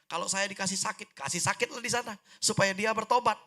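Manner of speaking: loud, high-pitched retold prayer; fervent prayer. Naive prayer to God for a sister's repentance, fervent. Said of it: "Jadi doa".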